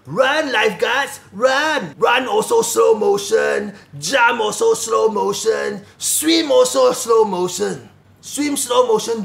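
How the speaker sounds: dragged-out slow-motion commentary; slow, mock-dramatic slow-motion delivery. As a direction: stretch every word as if in slow motion. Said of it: "and in slow motion".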